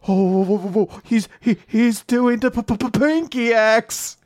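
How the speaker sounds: high, panicky mock stutter; flustered stammer. Host voicing Mash shocked at a gesture, high flustered stammer.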